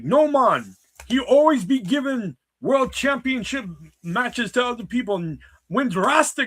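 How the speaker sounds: high, loud mock Jamaican Rasta; Rasta accent. Shown as a demonstration. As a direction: ham up a Rasta voice, high and forceful. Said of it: "doing the lines as Rasta".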